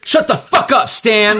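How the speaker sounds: high mock snap at Stan; retold Deadpool line. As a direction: toss it off like a cocky antihero. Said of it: "apparently he's like".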